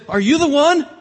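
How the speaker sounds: high, loud, eager asking; eager crowd question. Retold or imitated. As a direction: call out as an awed crowd. Crowd asks John, voiced high.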